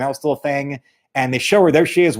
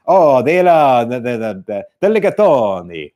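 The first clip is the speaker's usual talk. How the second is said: hammed-up fake Italian, stumbling; mock foreign accent; 4 dB louder than usual. Mocking pretentious travelers to a friend, hammed-up Italian accent. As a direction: open loud, then fumble through fake Italian. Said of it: "that kind of crap".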